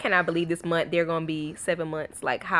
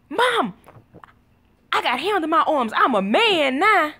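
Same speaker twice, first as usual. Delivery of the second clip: put-on higher, proudly announcing kid voice; imitated son; 7 semitones higher than usual. Son announces manhood to mom, proud. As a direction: declare it like a boy feeling grown. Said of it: "as soon as LeBron got out".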